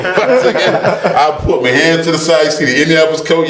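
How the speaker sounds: laughing. Real, not acted. Remembering jet photos.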